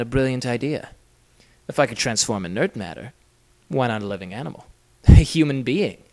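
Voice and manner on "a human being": louder, laughing disbelief; amused laugh. Acted. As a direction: laugh into the question. Inventor laughs at his daring idea.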